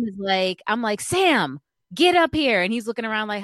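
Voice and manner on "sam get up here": loud, high call upward; calling the cat. Retold or imitated. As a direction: yell down to the cat below the porch.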